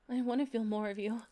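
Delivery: normal talking voice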